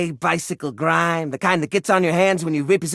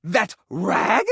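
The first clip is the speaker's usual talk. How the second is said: ordinary talk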